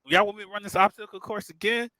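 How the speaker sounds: high, cocky bragging. Retold or imitated. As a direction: show off, full of swagger. Mocking his own bravado.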